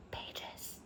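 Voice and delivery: breathy whispered word. Real, not acted. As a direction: drop to a whisper. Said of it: "trailing into a whisper".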